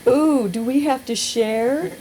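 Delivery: high, slow, sniffy "Ooh, do we have to share?"; drawn-out prissy disdain; undisguised distaste. Acted. Hiker woman sneers at sharing the shelter, high and disdainful. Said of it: "said with undisguised distaste".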